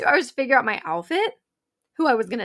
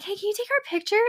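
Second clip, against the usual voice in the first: much higher, overly sweet ask; butter up the guard; asking the security guy for a photo, sugary and higher; 10 semitones higher, faster than usual. Retold or imitated. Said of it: "we're like".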